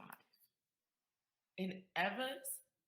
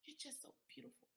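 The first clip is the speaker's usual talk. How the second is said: put-on child's voice; 7 semitones higher, 13 dB quieter, faster than usual. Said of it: "She goes".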